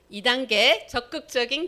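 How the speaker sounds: recite aloud. Shown as a demonstration. Teacher leading students to repeat the four steps, loud and clear. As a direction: lead the class to repeat each step. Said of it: "한번 따라해 볼까요?".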